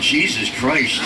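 normal talking voice

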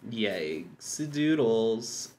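"Yikes the doodles" stretched very slowly; drawn-out echo. Retold or imitated. Repeats the phrase slowly.

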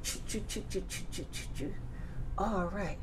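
soft chugging train chant; whispered choo choo. Acted. Teacher to toddler, chanting the train sound softly. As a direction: chug along softly like a train.

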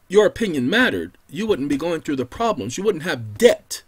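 ordinary delivery

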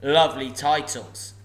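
loud, scornful dragon sneer; mock the riddler's titles; a dragon sneering at the thief. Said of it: "sneered the dragon".

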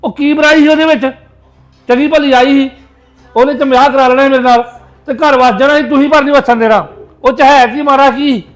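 loud, low-pitched outburst; angry reproach. Acted. Son blaming mother for his lover leaving, angry reproach. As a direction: blast her with furious blame.

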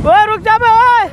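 high loud call after him; yelling stop. Real, not acted. Calling after the man as he runs off, loud yell.

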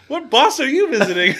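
high, laughing mock Boston question; loud comic Boston accent. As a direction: ask in a put-on Boston voice, giggling.

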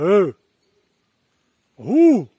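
loud whoop into the canyon; canyon echo. Real, not acted. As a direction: call out to hear the echo. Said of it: "É um eco estranho".

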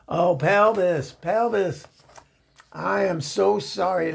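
high-pitched, pleading apology; mortified apology. Acted. Mortified apology for recording private call.

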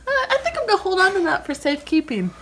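high, fast taunting; teasing. Real, not acted. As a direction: tease him, keep it quick. Taunting, holding his phone.

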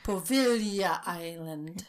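Poveglia said slowly and grandly, Italian style; with a hand gesture. Pretend. Playing along with the hand gesture, in a slow, grand voice. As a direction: say Poveglia Island like an Italian, with flair. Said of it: "you have to say it with the hand".

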